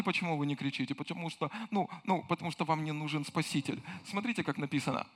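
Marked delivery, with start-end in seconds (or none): none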